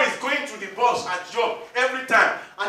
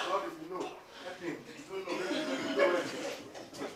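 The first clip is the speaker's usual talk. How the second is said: mock grudging prayer; 4 semitones lower, 11 dB quieter than usual. Believer praying for an enemy, mock muttered. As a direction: pray under your breath, grudging.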